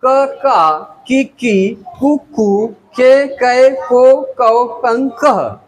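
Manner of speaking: chant-like drill from क, का to कह in pairs; measured classroom recital, क to कह, each syllable distinct; barakhadi recitation. Shown as a demonstration. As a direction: recite क, का, कि, की evenly through to कह. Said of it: "बारह खड़ी को ककहरा भी कहते हैं".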